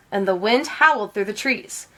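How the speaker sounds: ordinary delivery, nothing marked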